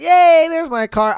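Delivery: high, bright little cheer; playful relief; mock cheer. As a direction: cheer on finding the car. Relief at finding car.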